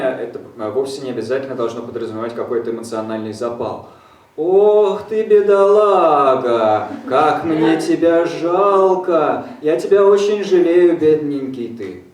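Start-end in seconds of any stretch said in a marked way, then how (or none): 4.4-12.0 loud, high, slow overdone pity; gushing pity. Retold or imitated. Interpreter mocks fake compassion, loud drawn-out pity. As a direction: wail it out like a fussing aunt. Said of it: "Это не сострадание".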